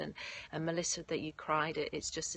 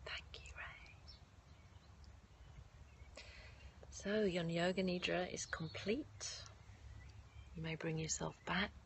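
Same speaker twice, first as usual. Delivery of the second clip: guided meditation; 9 dB quieter than usual. Acted. Leads yoga nidra meditation.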